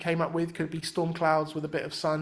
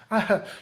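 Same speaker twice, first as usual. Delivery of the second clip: short laugh; 5 semitones higher, 4 dB louder than usual. Real, not acted. Laughing mid rap demo.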